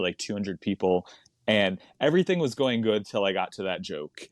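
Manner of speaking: normal talking voice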